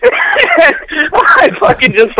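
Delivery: crying with laughter; words squeezed out through laughter; laughing helplessly at the memory. Real, not acted. Laughing while telling.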